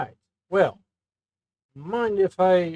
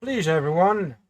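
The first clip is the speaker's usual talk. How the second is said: calling out; 6 semitones higher, 8 dB louder than usual. Acted. Calling everyone to stop.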